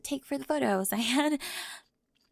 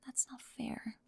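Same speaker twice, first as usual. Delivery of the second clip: barely voiced, wounded; hurt whisper; 8 dB quieter, slower than usual. Spring whispering back to Raven, hurt by her words. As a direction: breathe it out, stung. Hurt reply in emotional confrontation.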